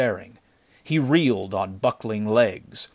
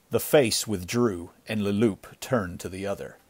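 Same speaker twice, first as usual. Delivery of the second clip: usual speaking voice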